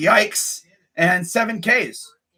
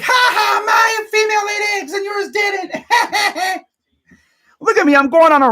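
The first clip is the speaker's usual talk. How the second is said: gloating rooster; 11 semitones higher, 3 dB louder than usual. Retold or imitated. Voicing the rooster bragging about eggs, gloating. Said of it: "They're like".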